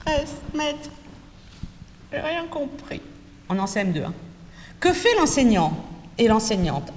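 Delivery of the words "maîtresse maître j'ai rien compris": high, soft little pupil voice; whiny child. Shown as a demonstration. Pupil calling the teacher, high soft whine. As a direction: plead like a lost child in class. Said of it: "ils vont faire comme ça".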